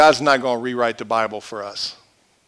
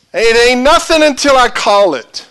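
high, loud umpire's declaration; Bill Clem; 9 semitones higher, 8 dB louder than usual. Acted. Umpire telling both teams who decides, loud declaration. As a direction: declare it with booming authority. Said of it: "One of his famous quotes".

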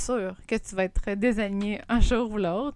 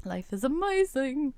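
dreamy; 6 semitones higher, slower than usual. Real, not acted. Pleased after the sip.

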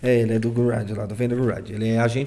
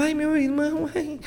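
high, whiny mock plea to his brother; high whining little brother begging for help; whiny Shun impression; 12 semitones higher, slower than usual. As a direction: play Shun as a whimpering little brother, pitched way up. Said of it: "a Shun fica incrível".

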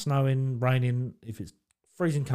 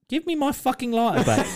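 tag; 10 semitones higher than usual. Retold or imitated. Retelling angry demand for lighter.

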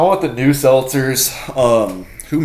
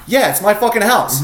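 tag; 9 semitones higher, 3 dB louder than usual. Retold or imitated. Host's emphatic retold welcome.

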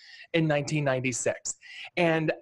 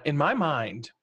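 usual speaking voice

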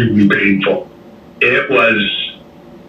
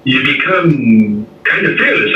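ordinary talk

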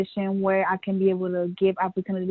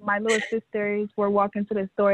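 usual speaking voice

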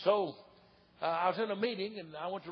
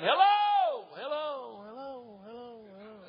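shouted hello fading to a faint echo; mountain holler echo; 4 semitones higher, 3 dB louder, slower than usual. Retold or imitated. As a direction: call out, then let it die away like an echo.

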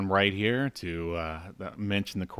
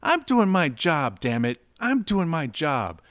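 mock yelling; 7 semitones higher, 3 dB louder than usual. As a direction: overplay the insistence, mock fury. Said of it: "and she says".

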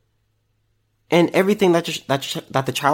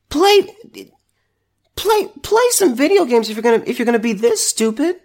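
exasperated outburst; 8 semitones higher than usual. Real, not acted. Telling bored kids to play games instead, high exasperated scolding.

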